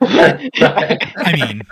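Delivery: laughing through the words; words broken by laughter. Real, not acted. Laughing at the joke.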